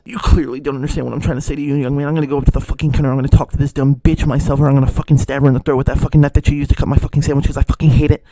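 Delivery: very loud, fast furious customer tirade; enraged rant. Retold or imitated. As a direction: explode into a rapid shouted rant as the customer.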